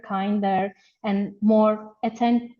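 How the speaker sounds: normal talking voice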